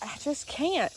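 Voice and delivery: ordinary talk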